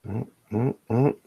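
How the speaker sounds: ordinary delivery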